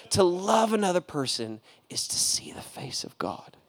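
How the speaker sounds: breathy hushed whisper; repeating the line as a reverent whisper. Acted. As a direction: breathe the words like a secret.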